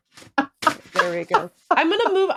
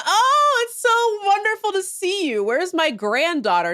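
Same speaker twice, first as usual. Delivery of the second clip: mock gushing dad; 9 semitones higher than usual. The deadbeat dad greets his daughter with fake sweetness. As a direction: ham up a fake-sweet long-lost father. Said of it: "he's like".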